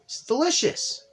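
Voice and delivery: squealed through a mouthful; high, excited with food in mouth; mouth full. Acted. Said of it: "talks with her mouth full".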